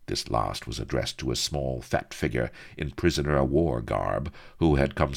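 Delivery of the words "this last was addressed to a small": ordinary delivery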